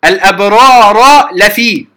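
slow الأبرار لفي with the ra kept apart; slow demo of الأبرار لفي, ra said; slow recitation of الأبرار لفي; clear ra demo. Shown as a demonstration. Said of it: "من إدغام".

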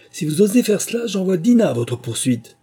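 high, loud threat; shouting with all her might. A narrator's reading. Alice yelling a threat at the animals, high and loud. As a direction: shout the threat through the window. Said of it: "cria de toutes ses forces".

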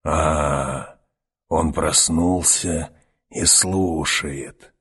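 very slow, low crone; a sly old witch drawing it out; old woman voice. Acted. Старуха говорит о мальчике. (медленный голос старой ведьмы)

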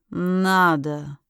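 low, joyless agreement; glum agreement. A narrator's reading. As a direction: agree heavily, without joy. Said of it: "Невесело согласился". (невесело, низким голосом)

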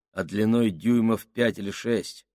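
usual speaking voice

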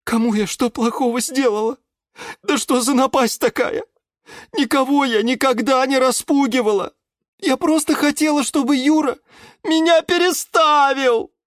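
very high wailing protest; high-pitched aggrieved whimpering; close to tears. A narrator's reading. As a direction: plead innocence, nearly crying. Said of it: "чуть не плакала герань Антонина".

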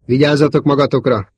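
warning call. A narrator's reading. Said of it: "intette a társait".

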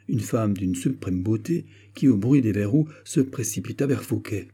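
usual speaking voice

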